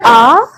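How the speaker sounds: आ sung out loud with the mouth wide open; open vowel demo. Shown as a demonstration. Teacher showing students the open vowel, loud full आ. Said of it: "कौन कौन से".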